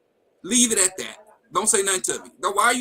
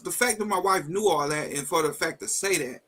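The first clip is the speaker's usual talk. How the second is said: ordinary talk, nothing marked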